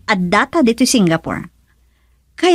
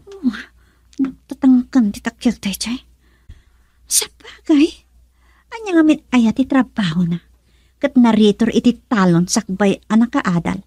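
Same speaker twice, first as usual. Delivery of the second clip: ordinary talk, nothing marked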